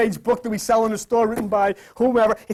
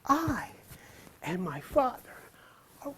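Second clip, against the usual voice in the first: said to yourself; 12 dB quieter than usual. Shown as a demonstration. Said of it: "We say that to yourself".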